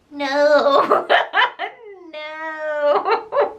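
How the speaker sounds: a whiny no breaking into laughter; laughing mock whine. Turning Dorian down in a laughing, whiny voice. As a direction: whine the refusal and crack up. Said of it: "I would just whine my response back".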